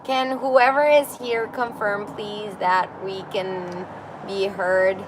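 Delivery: ordinary talk, nothing marked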